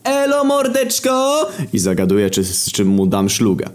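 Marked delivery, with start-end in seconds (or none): 0.0-1.5 high, drawn-out buddy greeting; slangy greeting. Acted. Grzegżu greeting the narrator from the bench, high drawn-out greeting. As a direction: stretch it out in a high put-on voice. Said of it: "pozdrawia mnie".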